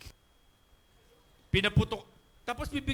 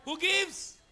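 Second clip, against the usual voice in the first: louder and higher, pushing the crowd; rousing preacher's call for an answer; call and response prompt; 6 semitones higher, 4 dB louder than usual. Shown as a demonstration. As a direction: call it out to rouse them. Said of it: "Parang wala kayong energy".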